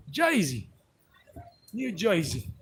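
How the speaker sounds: thick New Jersey accent, "Jersey" as "Joisey". Shown as a demonstration. Showing the accent used with his brother, Jersey accent. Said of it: "I got a different one when I'm talking to my brother".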